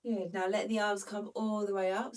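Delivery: usual speaking voice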